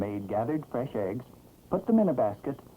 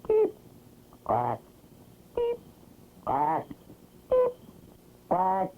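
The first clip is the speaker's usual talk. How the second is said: vintage cartoon voice; 7 semitones higher, slower than usual. Chick and duck call back and forth.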